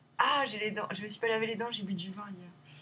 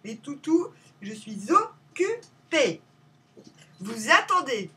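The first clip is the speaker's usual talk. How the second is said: loud, higher command, syllables stretched out; calling to dogs; 4 semitones higher, 9 dB louder, slower than usual. Real, not acted. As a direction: tell the dogs firmly to wait, word by word. Calls to her dogs to wait. (appel ferme aux chiens)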